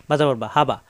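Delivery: ordinary talk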